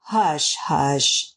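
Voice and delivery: low, tender hushing; loving. A narrator's reading. Husband comforts his weeping wife in bed, loving. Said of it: "with more love in his heart".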